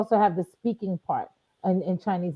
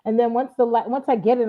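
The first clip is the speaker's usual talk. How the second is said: ordinary talk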